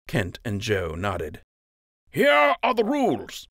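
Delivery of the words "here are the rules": much higher, loud commander's voice; Captain Fry announcing. A narrator's reading. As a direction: lay down the rules. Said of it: "Captain Fry said".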